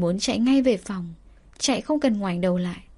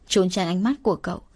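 ordinary delivery, nothing marked

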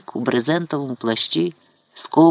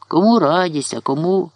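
ordinary delivery, nothing marked

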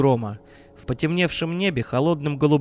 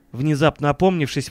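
plain reading voice

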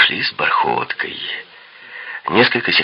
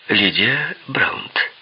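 slow, cool and hushed reply; cold; 6 semitones higher, much slower than usual. A narrator's reading. She gives her name to a flirt, coldly. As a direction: give the name coolly, taking your time. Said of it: "холодно ответила она".

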